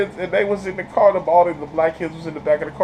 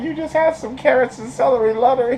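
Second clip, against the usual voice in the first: high, mocking scold; 8 semitones higher than usual. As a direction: scold him pitched high and prim.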